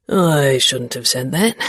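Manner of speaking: a hint of a teasing young man; Xavier voice. A narrator's reading.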